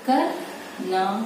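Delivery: Karnal stretched out very slowly; slow dictation. Shown as a demonstration. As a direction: draw out Karnal while writing. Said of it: "next आपका word है करनाल". (करनाल को धीरे खींचकर बोलना)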